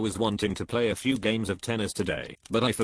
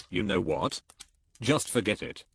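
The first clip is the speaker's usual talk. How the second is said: ordinary talk, nothing marked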